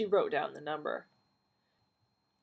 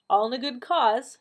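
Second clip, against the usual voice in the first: higher, louder put-on brightness; forced cheer; 6 semitones higher, 8 dB louder than usual. A narrator's reading. Donna to Doris in the call centre, forced cheer. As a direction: say it through a plastered-on smile. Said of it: "plastering on a smile".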